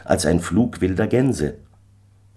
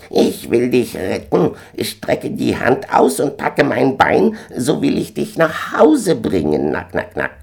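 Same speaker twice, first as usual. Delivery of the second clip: gander offering rescue; 7 semitones higher than usual. A narrator's reading. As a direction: squawk the offer, then cackle. Gänserich bietet Rettung an.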